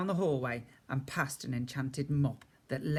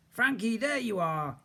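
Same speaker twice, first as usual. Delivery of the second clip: higher, slower friendly gardener voice; kindly gardener; 8 semitones higher, 3 dB louder than usual. A narrator's reading. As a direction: call down from the stairs, smiling. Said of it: "he said, smiling down at me".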